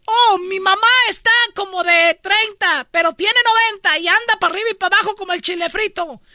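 high put-on voice of a young woman; impression.

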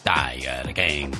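very deep, slow product name; infomercial. Acted. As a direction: drag out the brand name low.

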